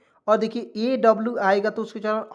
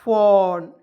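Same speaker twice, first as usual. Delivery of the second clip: loud model answer; 6 dB louder, much slower than usual. Shown as a demonstration. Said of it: "F A W N".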